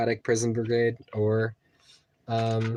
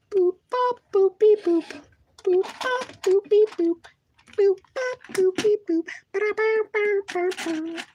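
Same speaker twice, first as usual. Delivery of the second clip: beep boop robot; 4 dB louder than usual. Pretend. Playful robot noises while building.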